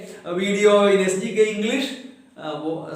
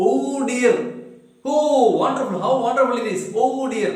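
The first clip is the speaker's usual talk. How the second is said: higher, mock-delighted oh dear; mild exclamation; 5 semitones higher than usual. Shown as a demonstration. Teacher demonstrates exclamation, lightly delighted. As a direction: show the wonder in oh dear. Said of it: "It's a word of exclamation".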